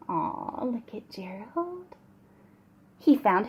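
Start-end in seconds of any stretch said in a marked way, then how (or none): 0.1-2.0 soft, hushed aw; tender aside. Real, not acted. Reader showing children happy Gerald, tender. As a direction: coo it gently.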